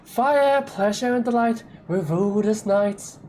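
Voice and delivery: high, slow, grandiose declaiming; dramatic poem recital. Acted. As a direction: declaim like a vain court poet. Poem's finale.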